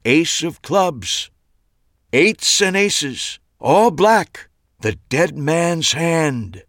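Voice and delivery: slow, high, ominous pronouncement; dramatic showman. A narrator's reading. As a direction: savor the dead man's hand with suspense. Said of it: "Max took a dramatic pause".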